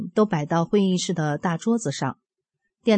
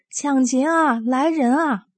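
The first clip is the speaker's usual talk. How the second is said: restrained cry for help, pitched higher; retold shouting; 6 semitones higher than usual. Woman calls out to neighbours as police raid her home, retold shout. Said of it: "我就使劲儿喊".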